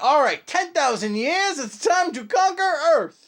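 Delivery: booming high villain proclamation; slow, grand evil overlord. Pretend. Villain planning world conquest.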